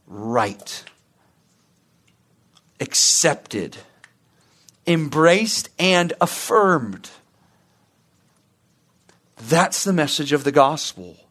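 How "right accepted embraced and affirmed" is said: God's tender verdict. Retold or imitated. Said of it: "looks upon you and says".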